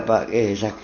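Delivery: normal talking voice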